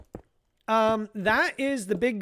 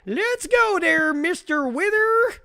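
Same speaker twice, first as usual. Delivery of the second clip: put-on Slim Pickens cowboy accent; 11 dB louder than usual. Streamer picturing Enigma charging the wither on horseback, whooping Slim Pickens cowboy accent. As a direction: holler it like a rodeo cowboy with a big Slim Pickens twang. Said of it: "he'd have to do a Slim Pickens accent".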